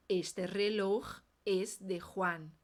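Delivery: slow, careful full sentence; "reloj" ends in a throaty J, strong R at its start. Shown as a demonstration. Teacher modeling the whole sentence slowly. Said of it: "vamos treinar".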